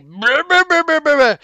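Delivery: high loud imitated grumbling brr; groggy brr noise. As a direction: mimic a sleepy startled grunt. Friend groaning awake.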